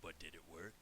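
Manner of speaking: low, soft, knowing question; smiling quiet retort. Retold or imitated. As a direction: drop it softly with a smile. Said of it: "smiles, and goes".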